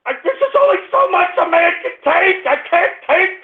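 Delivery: high-pitched, exasperated protest; overwrought pleading. Real, not acted. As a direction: plead at breaking point. At breaking point.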